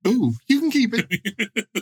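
slow, high and drawn out. Pretend. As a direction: stretch it out in a high voice. Acting out the orgy scene.

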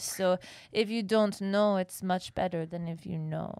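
put-on a light French accent held throughout; sustained French accent. Quoting the French climber to friends, light French accent. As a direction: play the French artiste, slow and earnest. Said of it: "you didn't have to say it in the accent".

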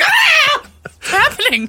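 high, loud mock scream; retold panic. Nanny retelling hearing parrot gunfire, hammed-up panicked scream. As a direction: shriek in exaggerated alarm. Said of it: "was like".

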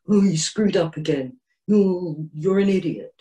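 lightly voiced sneering put-down; mean inner critic. Inner critic belittling you after a mistake, mild mock sneer. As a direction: scold yourself like a nasty teacher. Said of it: "the mean person going".

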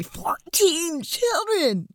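slow, high, stunned exclamation; amazed. Real, not acted. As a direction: stretch it out in disbelief. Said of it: "That is so mind-blowing to me".

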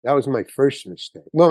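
ordinary talk, nothing marked

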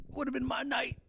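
high whining complaint with a yell; whiny wrestler. Retold or imitated. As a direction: whine and wail like a sore loser. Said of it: "Sammy bitching".

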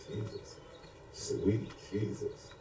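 normal talking voice

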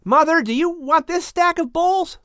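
put-on high boyish voice; an eager son helping pack; young Japheth. A narrator's reading. Japheth asks his mother about the bowls, high and eager.

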